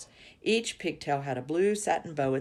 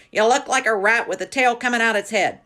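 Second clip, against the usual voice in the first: high loud mocking shout; 6 semitones higher, 8 dB louder than usual. A narrator's reading. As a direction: shout it down at the child. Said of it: "and shouted".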